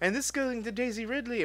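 high, sing-song announcer voice; mock commercial voice. Pitching a silly Star Wars video, high ad voice. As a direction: pitch it up like a cheesy promo. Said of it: "It was like".

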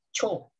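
chi and yo blended into one "cho"; merged syllable. Shown as a demonstration. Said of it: "lebih dirangkap dan chi sama yo".

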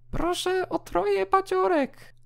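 very high, thin voice of a small soul; ghostly little soul voice. Acted. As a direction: squeak it out like a tiny ghost. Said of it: "Udaje głos". (bardzo wysoki, cienki głosik duszki)

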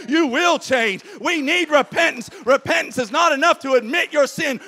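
loud, high, rousing preacher's call; full-force preaching. Acted. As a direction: preach it out to the congregation.

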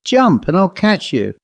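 a lightly voiced eager call. A narrator's reading. Young fellow to the girl on the hay, lightly calling out. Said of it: "cried the young fellow".

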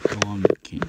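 low, understated play-voice retort; calm rival monster. Pretend. Rival monster disputing who is king, low retort. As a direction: counter it low and unruffled.

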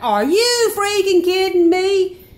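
high, loud, slow incredulous cry; stunned disbelief. Retold or imitated. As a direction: drag it out in total disbelief. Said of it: "I'm hearing it as he is".

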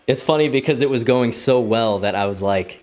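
ordinary talk, nothing marked

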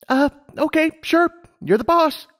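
put-on high, eager young mare; Lumina's voice. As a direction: voice her up high, keen and bright-eyed. Lumina, puzzled.

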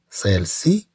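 ordinary delivery

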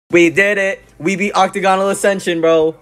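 high, loud victory cheer; excited cheering. Real, not acted. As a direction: celebrate the win loudly. Victory celebration.

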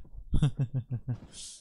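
delighted chuckling burst; pleased, rolling laughter; happy laugh. Real, not acted. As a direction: laugh with real pleasure at a small win. Happy after climbing up.

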